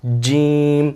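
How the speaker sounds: جیم with a clear m sound at the end; letter demo. Shown as a demonstration. Teacher models the letter جیم, ending on m. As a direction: close جیم firmly on the m. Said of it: "جیم کے آخر میں میم کی آواز آتی ہے".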